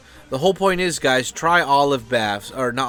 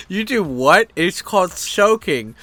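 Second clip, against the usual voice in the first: higher, louder imitation of disbelief; hammed-up astonishment, pitched up; mock incredulous; 6 semitones higher, 5 dB louder, slower than usual. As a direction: act out scandalised disbelief for laughs.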